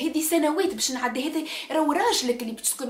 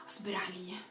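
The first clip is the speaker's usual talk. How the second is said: whispered, much softer; hushed advice; 7 semitones lower, 16 dB quieter than usual. Retold or imitated. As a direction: whisper it like a secret excuse. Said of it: "whispered mother's advice".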